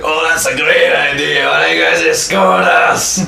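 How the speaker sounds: loud, high-pitched exuberant suggestion; tipsy Baron. Acted. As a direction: blurt it with drunken enthusiasm. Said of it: "Baron's been drinking all day".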